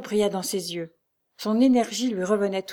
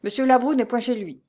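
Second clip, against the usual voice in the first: louder, higher, curt denial; dry, curt; 5 semitones higher, 5 dB louder than usual. A narrator's reading. Concierge's husband turning Lucie away, in a dry tone. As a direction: cut the visitor off drily. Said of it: "d'un ton sec".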